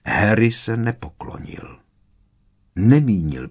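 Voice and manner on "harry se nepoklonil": ordinary delivery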